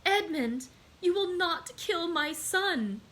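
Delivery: high, slow and pleading; a mother's desperate high plea; despairing plea. Acted. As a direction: beg him in despair. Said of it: "she said with an accent of despair".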